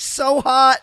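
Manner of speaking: loud, high mock enthusiasm; mock gushing. Mocking the popular kids at a festival, gushing loudly. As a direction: gush like an overexcited trend follower.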